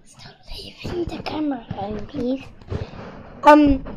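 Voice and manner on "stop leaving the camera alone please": hushed whispered request. Real, not acted. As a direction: whisper it so the camera misses it. Quiet aside to sibling.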